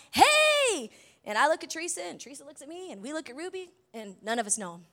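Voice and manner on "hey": high, loud, drawn-out jovial greeting; super jovial. Retold or imitated. Stranger walks into the pantry greeting everyone, super jovial. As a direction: hail them like long-lost friends. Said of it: "super jovial, like".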